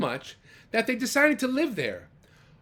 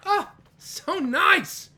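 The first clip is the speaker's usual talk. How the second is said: high slow gushing; admiring; 13 semitones higher, 6 dB louder, much slower than usual. Acted. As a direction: melt over the kindness. Reader admires policemen.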